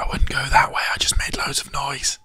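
hushed, breathy whisper. Real, not acted. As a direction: keep it to a whisper. Hiding near the killer.

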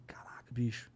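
low, hushed amazement; retold whispered disbelief; awed whisper. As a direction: breathe it out in a whisper.

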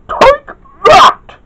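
high, loud attacking shout; very high-pitched character yell; battle yell. A narrator's reading. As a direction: yell it as you fire. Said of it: "yelled Kid".

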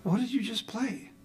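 high-pitched imitation of a wife; curious wife. Wife's voice in retold dialogue.

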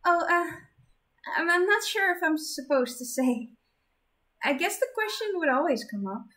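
put-on awkward shy girl; flustered girl voice. Flustered Zenith.

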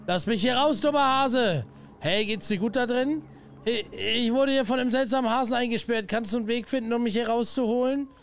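high-pitched, pleading captive; trapped character. Acted. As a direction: call for help from being locked in. Voices trapped game character.

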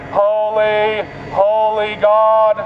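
ordinary delivery

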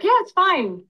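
dismissive. Retold or imitated. Mom's dismissive reply quoted.

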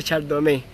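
Chardonnay ending in "né"; viewers' pronunciation. Shown as a demonstration. Streamer relaying two viewers' version, Chardonnay ending "né". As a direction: say Chardonnay as "char-do-né". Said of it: "Dice Georgina".

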